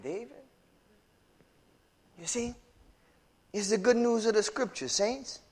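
tender comfort. Real, not acted. Gentle comfort to grieving parents.

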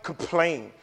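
normal talking voice

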